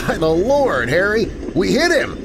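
high, eager working man's voice; excited train fireman. Acted. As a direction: tell it like a thrilled storyteller. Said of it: "cried a voice".

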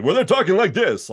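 high, loud forties radio pitchman; pushed vintage announcer voice; old-time commercial announcer. Acted. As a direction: project like a 1940s radio ad. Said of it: "radio or T V commercials of the forties".

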